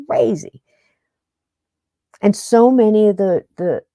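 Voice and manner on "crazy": a hushed, awed whisper. Real, not acted. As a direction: breathe "crazy" as a whisper. Host marvels at the wisdom in transcripts.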